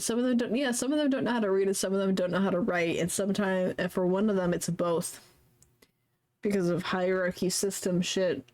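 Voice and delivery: ordinary talk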